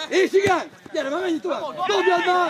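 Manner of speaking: high, loud sideline yell; rally the team at full voice; urging shout. Real, not acted. Spectator hurrying the throw along, shouting.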